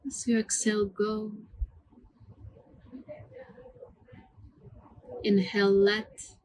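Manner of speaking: calm, hushed meditation voice with long pauses; guided meditation. Acted. Yoga teacher leading students through mantra breathing, soothing guided meditation. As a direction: guide the breath gently, word by unhurried word.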